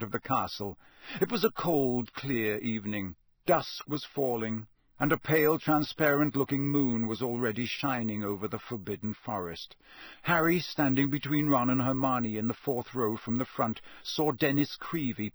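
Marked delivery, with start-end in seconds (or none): none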